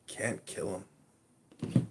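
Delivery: usual speaking voice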